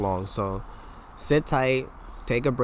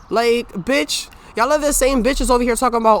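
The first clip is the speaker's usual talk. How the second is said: heated rant; 10 semitones higher, 5 dB louder than usual. Real, not acted. Ranting at cis women.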